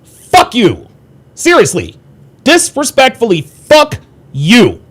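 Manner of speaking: real anger building at a disrespectful viewer; angry rant. Real, not acted. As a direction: blow up at the commenter, really meaning it. Rant at a chat commenter.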